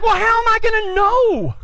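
high-pitched defensive comeback; exasperated retort. Retold or imitated. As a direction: whine back in protest, pitched high. Arguing back at his instructor.

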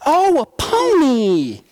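high, slow cooing over a pony; sweet "oh, a pony" said slowly. Retold or imitated. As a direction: coo it like a delighted little girl. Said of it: "She was like".

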